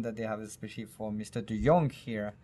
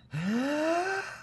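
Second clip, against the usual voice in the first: big breath in. Shown as a demonstration. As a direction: gulp in air to say it all in one breath. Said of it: "do it in one breath then".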